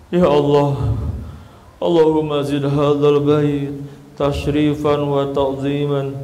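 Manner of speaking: slow, drawn-out mock-weepy prayer; imitated tearful prayer. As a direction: lightly act a pilgrim overcome in prayer, half chanting. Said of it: "oh itu nangis tuh".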